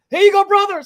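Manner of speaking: high, loud hand-over call. Retold or imitated. Bystander handing the cop his taser, calling out. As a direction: holler it to someone in a struggle.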